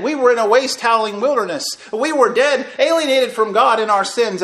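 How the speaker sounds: fast, loud, climbing preaching; fervent preaching. Acted. Preacher tells brethren they were dead, fervent. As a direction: preach it out with fire.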